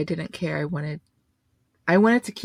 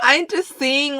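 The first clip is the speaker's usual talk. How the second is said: laughing; 10 semitones higher, 4 dB louder than usual. Real, not acted. Laughing after friend's bit.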